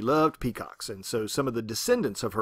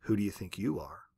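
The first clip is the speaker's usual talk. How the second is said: lower, softer echoing return; faraway echo; 5 semitones lower, 6 dB quieter than usual. Shown as a demonstration. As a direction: let it come back faint from far off. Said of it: "what would it sound like if that were to echo".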